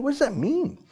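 high-pitched, baffled rhetorical question; incredulous question. Real, not acted. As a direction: throw it out in a high, exasperated pitch. Mocking vague wording of law.

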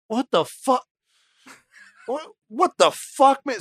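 hammed-up baffled Houdini; incredulous outrage. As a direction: play Houdini gobsmacked at the accusation.

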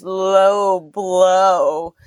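robot taunt. Retold or imitated. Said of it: "One goes".

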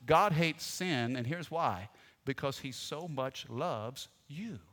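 slow, soft tender voice; tender reassurance. Real, not acted. Pastor gently assuring the church of God's love, soft and slow. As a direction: say it gently, slowly.

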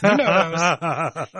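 drawn out slowly through laughter; laughing. Real, not acted. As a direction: savour it slowly, still laughing. Laughing repeat of the phrase.

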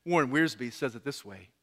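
normal talking voice

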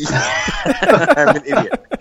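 slow, laughing self-mockery; laughing at himself. Real, not acted. Host laughs at himself after a pickleback mix-up, slow and laughing. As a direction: chuckle it out slowly, mocking yourself. Laughing at his own mistake.

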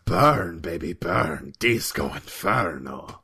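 low, slow, sultry one-liner; breathy James Bond danger; failed seductive. Shown as a demonstration. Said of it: "like your life is at risk".

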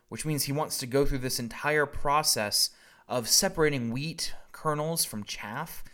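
normal talking voice

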